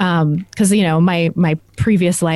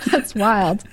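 laughing, higher; 7 semitones higher, 3 dB quieter, slower than usual. Real, not acted. Guest laughing at the host's lamb joke. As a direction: laugh out the words.

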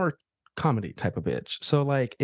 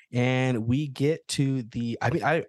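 normal talking voice

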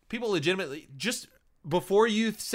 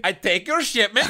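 put-on a loose Swedish accent for a smuggler line; lightly put-on Swedish accent, a touch higher and louder; playful Swedish-accented Han Solo; mock Swedish accent; 6 semitones higher, 9 dB louder than usual. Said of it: "I was doing, like, Swedish".

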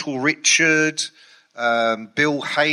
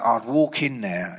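ordinary talk

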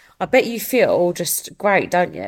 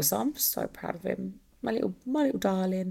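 tender; 8 dB quieter than usual. Real, not acted. Tender words about her son.

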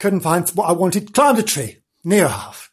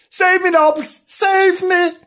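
very high squealed plea; hammed-up high-pitched panic; terrified kitten; 4 dB louder, slower than usual. A narrator's reading. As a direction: squeal for rescue. Kitten flees from dog.

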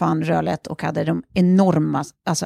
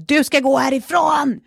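high, raised-voice order to leave; acted-out shouted command; retold shout; 8 semitones higher than usual. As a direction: re-enact yelling at an intruder from the stairs. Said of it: "ropar så här".

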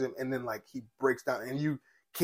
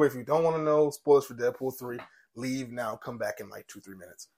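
usual speaking voice

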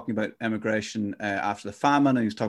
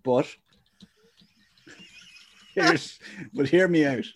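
mock author pitch; 4 semitones higher than usual. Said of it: "my book will be going".

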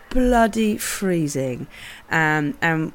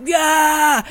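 loud, high mock battle cry; high-pitched hyped-up yell; excited imitation; 6 semitones higher, 3 dB louder, much slower than usual. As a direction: whoop it like a stoked snowboarder charging off. Acts out friend's excited cry.

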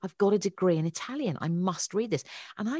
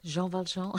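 put-on pretentious French accent; 4 semitones higher, 3 dB quieter than usual. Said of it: "hence the pretentious accent".

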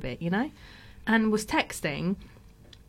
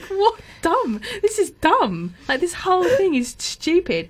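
much higher, louder exasperated protest; indignant outburst; 10 semitones higher, 5 dB louder, slower than usual. Retold or imitated. Retelling her reaction to the detention sheet, loud high indignation. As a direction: throw it back at the teacher in disbelief. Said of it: "I just was like".

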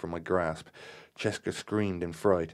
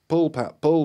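high, loud command; urgent yell; 10 semitones higher, 11 dB louder than usual. A narrator's reading. Terry and Marion urge Pat on, yelling. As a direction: yell it to make them pull. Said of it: "yelled in unison".

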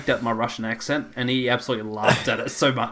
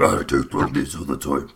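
much lower mock voice; deep imitation of the friend; mock deep modulated voice; imitating deep modulator voice; 11 semitones lower than usual. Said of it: "and you".

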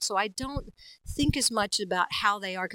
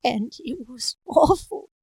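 spooky storyteller; slower than usual. Shown as a demonstration. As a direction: tell it like a horror tale. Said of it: "other ones was like".

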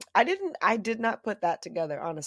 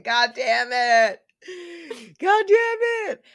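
slow, loud wail jumping higher; mock lament; 6 semitones higher, 5 dB louder, slower than usual. Co-host bemoans a Friday death scene, hammed-up exasperation. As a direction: stretch it out into a high mock cry.

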